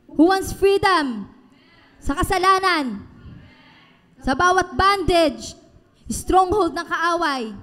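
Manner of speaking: slow, loud and high preacher call; preacher rallying the church to freedom, slow loud calls; rallying shout. Acted. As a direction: rouse the crowd word by word.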